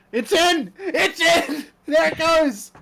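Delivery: loud, high cheering shout; excited cheer. Real, not acted. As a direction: cheer it in like a goal. Cheering the chili going in.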